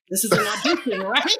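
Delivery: asked through a nervous laugh; laughing question. Retold or imitated. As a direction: laugh it out, unsure. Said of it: "So I'm like".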